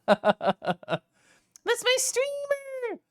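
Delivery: stretched-out high cry, slow; high drawn-out squeal. Pretend. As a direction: hold the squeal out long. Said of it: "One really low take".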